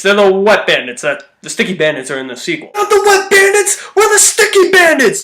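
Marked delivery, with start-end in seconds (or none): 2.7-5.2 high, put-on dopey burglar voice; hammed-up high crook voice; movie burglar voice. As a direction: play the bumbling crook, pitched way up. Acting Home Alone burglars' line.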